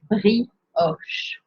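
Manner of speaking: "brioche" in two careful parts, bree then osh; careful French pronunciation. Shown as a demonstration. Teacher making sure learners get this one right, careful French pronunciation. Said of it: "make sure that this one is".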